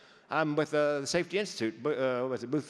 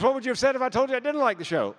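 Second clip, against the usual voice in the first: mock challenge; 9 semitones higher, 7 dB louder than usual. Acted retort from woman on cruise.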